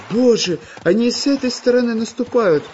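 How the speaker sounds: high, alarmed shout of warning; frightened cry. A narrator's reading. Chizhik warns friends of the attacking plants, frightened cry. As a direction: cry out, pointing at danger. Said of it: "закричал Чижик".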